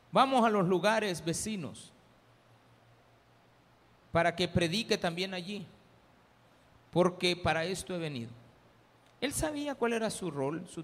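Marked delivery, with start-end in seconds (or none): 0.1-8.3 louder, resolute announcement; firm declaration. Retold or imitated. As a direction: declare the mission with conviction. Jesus answering the disciples firmly.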